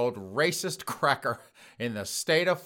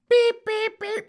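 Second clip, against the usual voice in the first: high loud beeping like a bleep tone; mock censor beep; 8 dB louder, slower than usual. Host bleeping the old woman's slurs, a high loud beep. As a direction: beep out the slur in a shrill tone.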